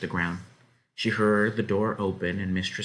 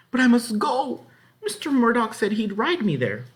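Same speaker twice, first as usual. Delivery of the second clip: high, loud desperate plea; pleading girl; 5 dB louder than usual. A narrator's reading. Girl pleads with the farm wife to let her ride, desperate. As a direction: beg to be let go.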